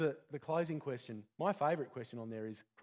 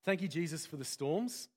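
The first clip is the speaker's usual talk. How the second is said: soft, unhurried prayer voice fading to quiet; closing prayer; 3 semitones lower, 3 dB quieter than usual. Acted. Pastor leading the congregation in closing prayer: soft, fading prayer. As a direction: pray it gently, letting it settle.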